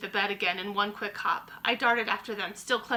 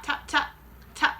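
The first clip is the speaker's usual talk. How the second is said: plain reading voice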